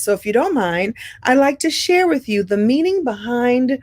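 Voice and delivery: ordinary talk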